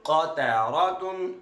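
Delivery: क़तारतुन stretched slowly and clearly; slow blended word. Shown as a demonstration. Teacher repeating the word slowly for the child. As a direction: drag out क़तारतुन for the learner. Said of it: "teacher repeats joined word".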